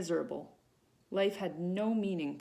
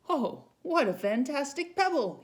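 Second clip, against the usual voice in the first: high, loud delighted exclamation; exclaimed; 8 semitones higher, 6 dB louder than usual. A narrator's reading. Father spotting the red pebble, loudly delighted. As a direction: marvel at the find. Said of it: "he exclaimed".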